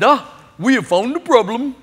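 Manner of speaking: imitated East Indian accent; put-on East Indian accent. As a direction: gate agent's discovery in an East Indian accent. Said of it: "from an East Indian accent".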